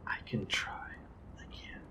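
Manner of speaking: hushed, unvoiced reply; whispering. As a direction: barely breathe the words. Prisoner answers whispering.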